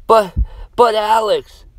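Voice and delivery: high, loud broken cry with a stumble; anguished cry. Acted. Samantha protests leaving Alex, crying out. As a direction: cry out for the lost friend. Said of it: "Samantha cried".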